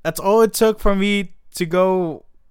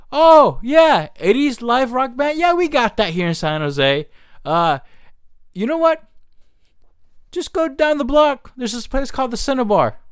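fake helpful; 8 semitones higher than usual. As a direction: lure them in with false cheer. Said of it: "I was gonna be that ruthless".

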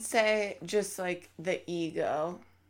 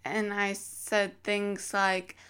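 ordinary talk, nothing marked

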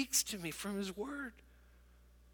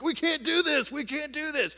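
mock distressed teens; 8 semitones higher, 4 dB louder than usual. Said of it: "skateboard guys are like".

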